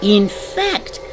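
slow, loud storytelling finish; emphatic story ending. A narrator's reading. Narrator closes the lion story, slow and emphatic. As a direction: draw out each phrase loudly.

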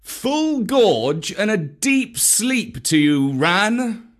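a light panther's cry of thanks; Bagheera's call. Acted. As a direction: call a blessing up to the kite. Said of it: "cried Bagheera".

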